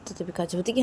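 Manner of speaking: plain reading voice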